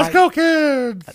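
very high, loud, drawn-out falsetto; creepy Mickey Mouse voice. Acted. Co-host calling kids along in his creepy version, squealing Mickey. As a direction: stretch it out as a squealing cartoon mouse. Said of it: "how you want Mickey Mouse to sound".

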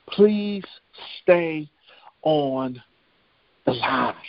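word by word, long pauses, stepping down in pitch; deliberate refrain. Retold or imitated. Preacher driving home the operator's instruction, deliberate word-by-word refrain.

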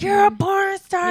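loud, high mock heckle; rude call-out. Club-goers rudely calling out a porn star, rude heckle. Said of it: "they will rudely be like".

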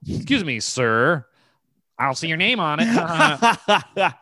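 high-pitched mock-childish politeness; half adult, half child. Comedian mimics the line-cutter's comeback, half adult, half child. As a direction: put on a high, teasing grown-up-child voice. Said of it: "a mixture of an adult and child".

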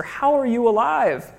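lightly acted stunned lover asking how she lives; astonished question. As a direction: ask it as if seeing a ghost. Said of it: "when he asks her".

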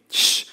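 imitated shush; brief retold "shh"; crowd's shushing. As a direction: shush someone as the crowd would. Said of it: "they are shushing him".

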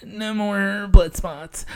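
high, slow put-on comic voice; Robin Williams bit. Comic channeling Robin Williams for the crowd, high slow voice. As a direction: channel a manic comedian, drawn out. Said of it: "channeling Robin Williams".

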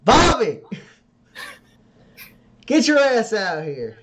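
high, loud yell toward the saloon; calling out. Acted. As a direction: holler him out of the bar. Michelle yelling for Bobby.

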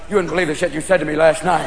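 talking dog. Acted. Dog to hungover owner, low muted voice. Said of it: "Even your dog's looking at you like".

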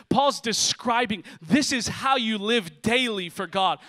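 loud, ringing pulpit delivery; loud, high-energy sermon preaching; full-force preaching. Acted. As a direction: preach it out to the whole church.

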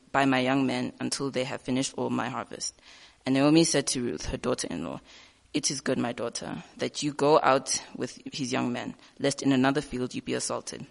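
normal talking voice

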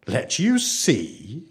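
very slow, drawn-out question; slowly. A narrator's reading. Village girl to pleading children, slow and doubtful. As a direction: stretch each word as if weighing it. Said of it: "she said slowly".

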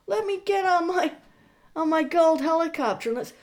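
high, eager mock Trump son on a call. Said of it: "How does that call go?".

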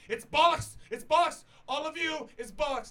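high, loud angry outburst; old man ranting in security's face; hammed-up furious shouting; mock outraged yelling.

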